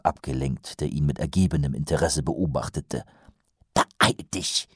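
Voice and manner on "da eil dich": urgent breathy hiss; hissed whisper. A narrator's reading. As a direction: hiss it under your breath. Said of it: "zischte Wonse".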